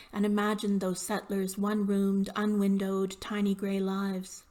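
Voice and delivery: firm narrative poem recital; measured, projected poetry voice; poem reading. Acted. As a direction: read the poem out with steady emphasis. Reads own poem aloud.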